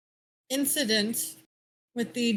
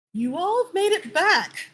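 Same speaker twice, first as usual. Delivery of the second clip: relieved, slow and a bit higher; relieved captain; 7 semitones higher, 3 dB louder than usual. Acted. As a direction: greet them with relief, unhurried. DM voices relieved High Captain.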